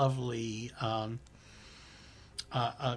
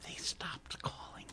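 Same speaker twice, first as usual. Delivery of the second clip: whisper; 3 semitones higher, 14 dB quieter than usual. Real, not acted. Aside while running the console.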